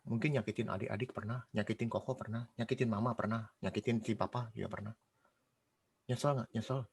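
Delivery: subdued personal confession; quiet confession. Real, not acted. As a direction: own up softly, pitch sinking. Confessing hurt done to own family.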